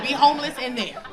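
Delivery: normal talking voice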